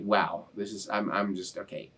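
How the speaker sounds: fading to almost nothing, overwhelmed; words dying away as emotion wins; overcome, barely audible. Real, not acted. Thanking his community, too moved to go on, near-silent. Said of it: "gonna get really emotional".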